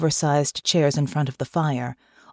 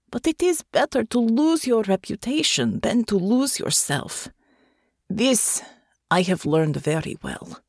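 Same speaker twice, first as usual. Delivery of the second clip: put-on firm, lightly Russian-accented reply; Russian-accented woman; 5 semitones higher than usual. A narrator's reading. Natasha answers Hansen.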